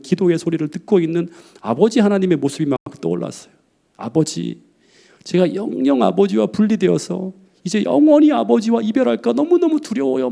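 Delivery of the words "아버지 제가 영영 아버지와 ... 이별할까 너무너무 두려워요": much higher, pleading in dread; Jesus in Gethsemane pleading with the Father, anguished; anguished prayer. Retold or imitated. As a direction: cry out to the Father in fear. Voicing Jesus praying in Gethsemane.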